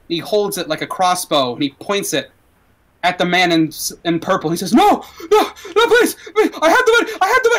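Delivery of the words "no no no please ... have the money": high, loud, desperate begging; pleading. Acted. Man in purple begging Axel not to shoot, terrified. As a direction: beg for your life.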